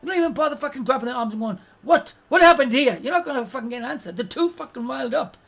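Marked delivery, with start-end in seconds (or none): 1.8-3.0 loud, higher-pitched confrontation; mock stern demand. Adult breaking up fighting kids, loud mock demand.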